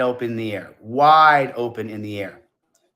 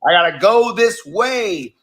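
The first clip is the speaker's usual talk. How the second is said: mock voice; 9 semitones higher, 4 dB louder than usual. Jokey sign-off voice.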